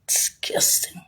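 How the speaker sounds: breathy whispered contempt; a whispered sneer; whispered disgust. Real, not acted. Muttered disgust after laugh.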